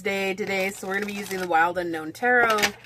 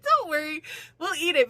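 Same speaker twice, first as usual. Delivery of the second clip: reassuring wife; 8 semitones higher than usual. Retold or imitated. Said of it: "She's like".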